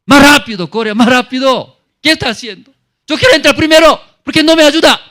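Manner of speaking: high, loud impatient yelling at helpers; impatient demanding. Acted. Sick man snapping at his helpers, loud high demanding voice. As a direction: shout at them to hurry, shrill with grievance. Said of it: "¿Así habla? No es".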